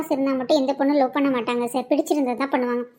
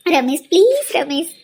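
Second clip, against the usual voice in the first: pleading; 3 semitones higher, much slower than usual. Acted. Girl begs Ramesh to take her.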